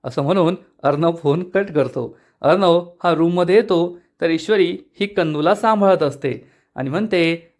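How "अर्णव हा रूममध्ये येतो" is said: ordinary delivery, nothing marked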